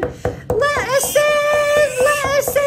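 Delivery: high, slow pleading pig voices; high piggies pleading at the door; panicked little pigs. A narrator's reading. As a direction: beg high and drawn out.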